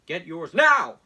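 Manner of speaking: loud, pushy sales-pitch voice; mock campaign ad announcer; hammed-up ad read. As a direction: sell it like a cheesy infomercial. Reading Trump campaign text aloud.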